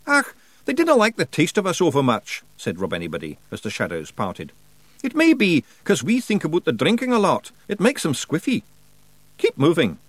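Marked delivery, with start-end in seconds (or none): 0.1-2.4 high, loud Scots pixie voice; brash wee Scottish fighter; Feegle voice. Acted. Rob Anybody brushing off Roland's doubts, high and brash. 5.0-10.0 high, loud Scots pixie voice; brash wee Scottish fighter; Feegle voice. Acted. Rob Anybody brushing off Roland's doubts, high and brash.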